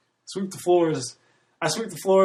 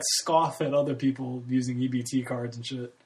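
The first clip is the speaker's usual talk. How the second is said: ordinary talk, nothing marked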